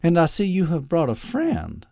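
high, bright exclaim of delight. A narrator's reading. Southern mother greeting son's friend, delighted. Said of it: "exclaimed with delight".

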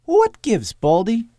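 very high, loud, cocky pipsqueak; aggressive little tough guy. Acted. Topper confronting Doctor Loeb, aggressive high voice. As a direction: challenge him in a high, pushy voice. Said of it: "jutting his chin out aggressively".

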